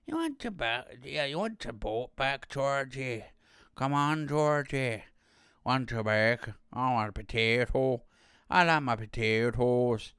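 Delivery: hammed-up Irish clown voice; mock Irish accent. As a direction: put on a comic Irish lilt. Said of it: "like, Irish on that one".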